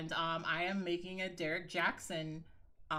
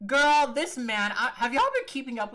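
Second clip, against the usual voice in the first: exasperated gossip opener; 6 semitones higher, 10 dB louder than usual. Real, not acted. Vlogger opens the gossip to her viewers, exasperated and loud. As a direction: call out to the audience like a friend with tea.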